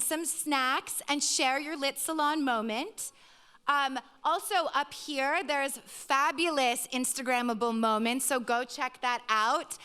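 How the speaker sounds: host winding up the evening for the audience; event host address. Real, not acted. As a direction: thank the crowd and wrap up the show.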